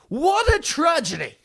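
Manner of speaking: sarcastic high cry of what a tragedy; mock tragedy. Mock lament over resignation.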